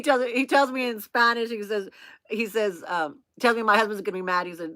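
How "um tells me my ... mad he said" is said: ordinary delivery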